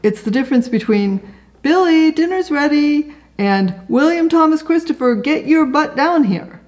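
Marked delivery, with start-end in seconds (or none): 1.6-3.1 mom calling to dinner. Retold or imitated. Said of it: "your mom calling you to dinner". 3.9-6.6 high, full-name scolding call; a mom out of patience yelling up the stairs; stern mom. Retold or imitated. Mom summoning her son by his full name, high and stern. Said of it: "a little more authority".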